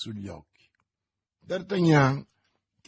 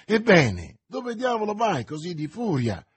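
raised, higher-pitched challenge; shouting; 5 semitones higher, 5 dB louder than usual. A narrator's reading. Friend stopping D'Artagnan at the door, shouting. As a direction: call out sharply after a friend. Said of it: "gli gridò Athos".

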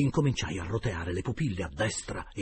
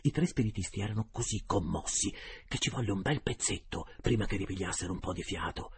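usual speaking voice

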